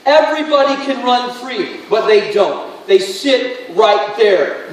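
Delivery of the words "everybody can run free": louder, higher repeat. Shown as a demonstration. Preacher repeating to the congregation, now louder and higher. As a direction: push it out louder the second time, higher and stronger.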